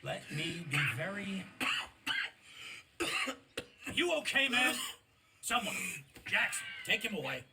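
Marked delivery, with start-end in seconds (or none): 1.5-3.9 long rasping coughing fit. Acted. Chief interrupts the colonel with a long rasping coughing fit. 3.9-7.4 higher, louder alarmed call; calling for help. Acted. Colonel calls his aide to take the coughing chief away, alarmed.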